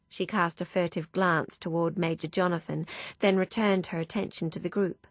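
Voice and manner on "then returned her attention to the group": ordinary delivery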